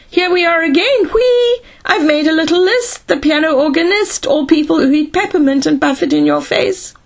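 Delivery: high, giddy, chanting nonsense; crazed singsong robot. A narrator's reading. Crazed Speedy greeting the two men, in giddy high singsong. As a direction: trill it out high like a loopy robot.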